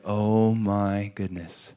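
slow, softened wonder; awed amazement. Retold or imitated. Passenger seeing the ribbons on the house, awed amazement. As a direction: draw it out, hushed and stunned.

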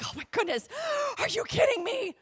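over-the-top thrilled disbelief with a gasp; mock hyperventilating. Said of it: "I, like, like to hyperventilate".

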